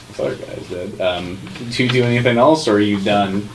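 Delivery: normal talking voice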